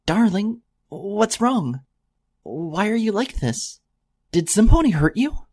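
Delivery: high, caring questions, slow in the middle; tender worried mare. A narrator's reading. Mare shakes the stranger's shoulders, high worried tenderness. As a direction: coax an answer from a hurt stranger.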